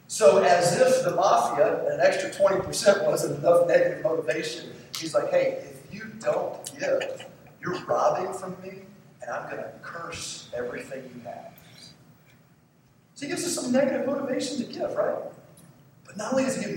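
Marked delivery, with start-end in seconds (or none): 5.3-11.5 slow, hushed threat, sinking softer; quiet menacing warning. Acted. God warns those who don't give, quiet menacing warning. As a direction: deliver the warning slowly and softly.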